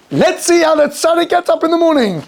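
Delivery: high, sustained announcer-like call; hammed-up spectator announcement; expectant onlookers. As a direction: build suspense like a watching crowd. Voicing watchers of the alarm camera.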